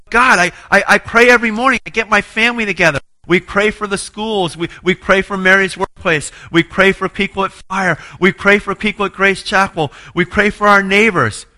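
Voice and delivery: high, loud, insistent list of good deeds; pleading protest. Retold or imitated. Man arguing his record to God, loud and insistent. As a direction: shout your case up to God. Defending himself to God in prayer.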